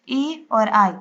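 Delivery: ordinary delivery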